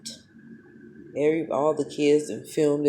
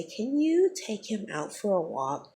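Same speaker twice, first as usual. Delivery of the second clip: mocking the teacher; 5 semitones higher than usual. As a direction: imitate her fake polite request, drawn out. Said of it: "she gonna look at me and say".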